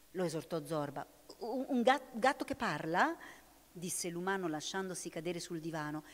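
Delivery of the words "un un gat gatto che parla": stuttering astonished question, high and louder; stammering disbelief. A narrator's reading. Man collapsing on the sofa, stammering in disbelief. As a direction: trip over the words in shock. Man stunned by talking cat.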